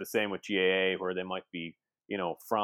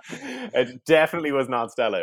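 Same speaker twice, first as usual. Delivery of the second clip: laughing; 6 semitones higher, 7 dB louder than usual. Real, not acted. Laughing denial about the beer.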